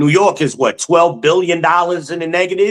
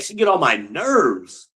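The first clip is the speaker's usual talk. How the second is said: ordinary talk, nothing marked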